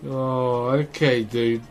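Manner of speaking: normal talking voice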